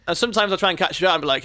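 ordinary delivery

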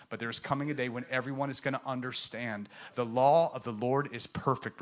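ordinary talk

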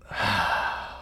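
slow, audible breath out, no voice; long exhale. Shown as a demonstration. Preacher leading listeners, breathing out with a long sigh. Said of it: "Take a deep breath. Let it out".

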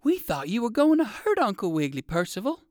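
high-pitched little character voice; Arabella, still alarmed. A narrator's reading. Arabella explains her fear to Percival, alarmed.

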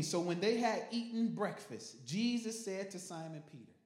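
loud preaching, scripture read with force. Acted. Pastor preaching the text to the congregation, loud. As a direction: preach it out to the church.

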